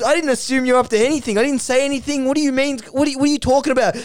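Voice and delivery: high, hurried denials; defensive protest. Acted. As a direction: sputter back in acted exasperation. Acted defensive reply in quarrel.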